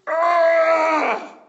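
loud, high, disgusted "Ugh!"; a fed-up older brother's outburst; angry grunt. Acted. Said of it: "He goes".

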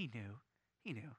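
soft, low repeated he knew; hushed. Real, not acted. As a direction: lean in and drop the voice. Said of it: "hushed emphasis".

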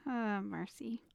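ordinary talk

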